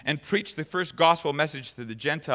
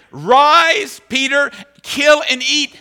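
higher, louder, slow booming command; voice of God; 11 semitones higher, 13 dB louder, much slower than usual. Retold or imitated. Preacher voicing God commanding Peter, loud and high. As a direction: call it down from heaven. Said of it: "the most startling words".

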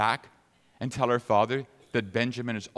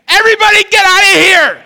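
much higher and much louder, one long cry; bursting shout; 19 dB louder than usual. Acted. Joseph ordering every Egyptian out of the room, full shout. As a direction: yell it like you are about to burst.

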